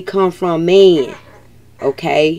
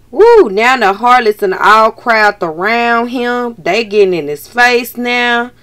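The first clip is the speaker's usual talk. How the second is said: usual speaking voice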